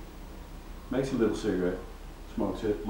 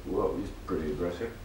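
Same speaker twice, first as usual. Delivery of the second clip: normal talking voice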